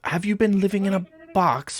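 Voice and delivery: high, loud, quick disbelieving jab; scoffing incredulity. Acted. Kenku to visitor who missed the news, scoffing incredulity. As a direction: scoff as if it's common knowledge.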